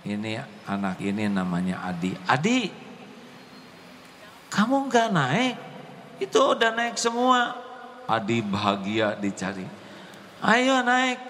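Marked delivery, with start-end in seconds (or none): 2.2-7.5 put-on high child calling. Classmate calls Adi from bus. 10.4-11.2 put-on high child calling. Classmate urging Adi aboard.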